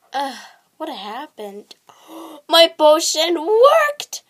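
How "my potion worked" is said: loud slow triumphant cry; excited. Acted. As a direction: gasp then gloat. Witch's spell succeeds.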